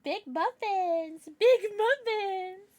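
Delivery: high, drawn-out cutesy cooing. Real, not acted. Streamer teasing the cat about muffins, high baby talk. Cooing at pet cat's belly.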